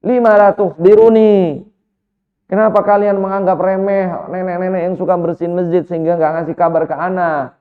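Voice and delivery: retold indignant outburst; angry rebuke. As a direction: rebuke them, hitting the opening hard and easing slightly. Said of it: "Rasulullah marah, jemaah".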